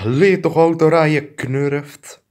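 ordinary delivery

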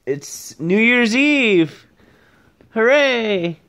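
slow, high, loud celebration; excited cheer. Real, not acted. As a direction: cheer it out for New Year's Eve. Cheering New Year's Eve.

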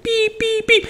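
very high, drawn-out beeps; slow-motion beeps. Retold or imitated. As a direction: make the beeps sound slowed down. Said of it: "crazy in slow motion".

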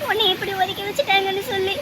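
crying as she speaks of being shunned; tearful. Acted. Mother to daughter about being shunned, crying. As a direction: voice breaking into a cry.

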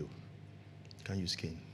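slow, soft whisper; whispered aside. Real, not acted. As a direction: hush it like a secret.

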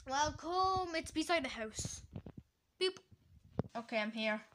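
ordinary talk

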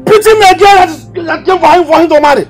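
high-pitched angry tirade; outraged rant. Acted. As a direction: rage indignantly, pitch far up. Father rages at son's behaviour.

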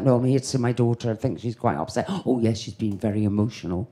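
quiet low exchange, murmured at the door; hushed. Retold or imitated. Mother and doorman speak hushed about her upset daughter. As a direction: keep it down, discreet worried mother.

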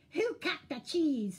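pointed, squawked question; rat. A narrator's reading. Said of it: "said the rat".

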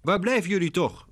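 high, impatient call. A narrator's reading. McDonald calls for her passengers, impatient. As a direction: call out, tired of waiting. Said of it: "roept ze ongeduldig".

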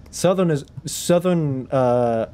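normal talking voice

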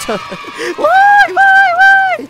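very high loud squealed cheer; squeaky cheer. Pretend. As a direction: squeal it out in falsetto. Mock cheer after win.

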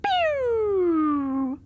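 playful zap noise; quick cartoon laser pew; laser pew sound. Testing the steering controls.

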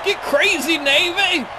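high, loud battle cry; rallying cheer. Real, not acted. Gamer rallying his Navy team, loud and excited. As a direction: pump up the crowd.

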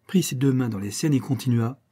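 plain reading voice

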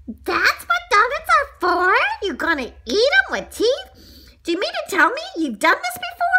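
high, loud indignant little voice; outraged donut character. A narrator's reading. Donut confronts his would-be eater, shrieking outrage. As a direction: scold him in a high, loud squeal.

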